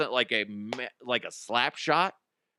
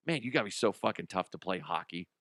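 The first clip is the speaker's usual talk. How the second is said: ordinary delivery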